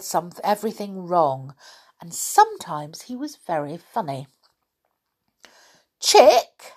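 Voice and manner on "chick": much higher, loud puzzled call; mishearing Saucepan Man. Acted. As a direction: mishear the name with gusto. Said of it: "he said".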